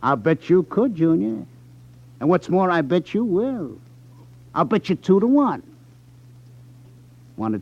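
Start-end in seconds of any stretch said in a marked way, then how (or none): 0.0-5.6 put-on a louder, cocky needling of the kid; taunting. Club owner goading the indebted kid into cheating friends, taunting. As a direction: rub it in, sure he'll win the bet. Gambler taunting a cornered young debtor.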